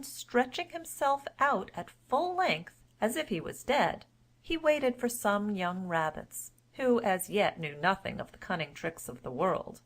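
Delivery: ordinary delivery, nothing marked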